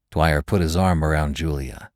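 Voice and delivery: ordinary delivery, nothing marked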